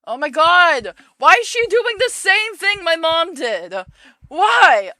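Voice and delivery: real, high and loud cry of disbelief; shocked outburst. Real, not acted. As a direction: blurt out in shock. Reader reacts shocked to the story.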